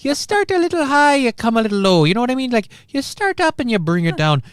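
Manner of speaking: high, lilting stage-Irish coach; Irish accent. Acted. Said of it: "in an Irish accent".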